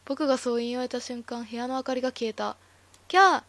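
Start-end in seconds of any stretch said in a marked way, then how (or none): 3.1-3.4 piercing high scream of fright; Ran screaming in the sudden dark; terrified scream. Acted. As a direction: shriek as the lights go out. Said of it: "ランは叫び声を上げて".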